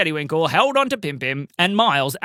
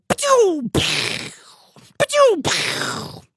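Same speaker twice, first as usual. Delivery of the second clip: high popping pachoo; firework noise; 13 semitones higher, much slower than usual. A narrator's reading. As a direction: make the launch sound. Banana fireworks launched.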